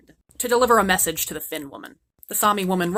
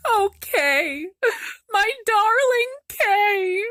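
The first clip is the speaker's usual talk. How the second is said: put-on very high, slow, swooning girl; hammed-up lovesick sigh; lovesick Gerda; 13 semitones higher, much slower than usual. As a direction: melt with longing for your darling. Gerda pining for Kay.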